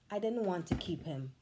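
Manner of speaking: plain reading voice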